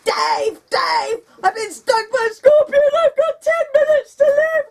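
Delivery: high, loud, frantic screams for help; panicked screaming. Retold or imitated. Wife screaming to sleeping husband after a scorpion sting, panicked shrieking. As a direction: scream for your husband like you've been poisoned. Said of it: "I screamed loudly".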